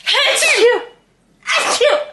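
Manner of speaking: two loud high sneezes. Real, not acted. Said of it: "Guys, I gotta sneeze".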